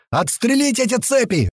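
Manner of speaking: high, urgent shouted order; echoing yell. A narrator's reading. Second fighter echoing the order, yelling. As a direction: take up the shout from your mate. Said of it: "вторит другой".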